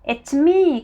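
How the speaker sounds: high, loud call through the door. A narrator's reading. Mister Happy calls through her front door, calling out. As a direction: call out who it is. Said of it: "called out Mister Happy".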